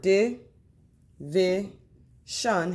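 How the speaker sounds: "di, vi, shun" with a clear pause between each; separated syllables. Shown as a demonstration. Teacher showing a word's parts, "di, vi, shun" spaced apart. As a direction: sound out "di, vi, shun" one by one. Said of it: "has three syllables".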